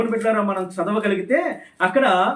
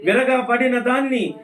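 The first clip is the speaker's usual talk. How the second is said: raised, declamatory Bible reading; scripture proclamation; 4 semitones higher, 3 dB louder than usual. Real, not acted. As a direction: ring out each phrase, stretching the endings. (లేఖనాన్ని గట్టిగా ప్రకటిస్తూ చదవడం)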